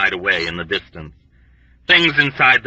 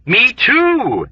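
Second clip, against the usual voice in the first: high, bright burst of joy; rejoicing; 13 semitones higher, 4 dB louder than usual. A narrator's reading. Patient sharing the news, rejoicing. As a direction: cheer it like a prize won. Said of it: "A Fortiori rejoiced".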